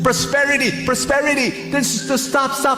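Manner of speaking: high pleading call across the water; desperate calling out. Acted. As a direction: call after the boat, begging to board. Love calls after departing boat.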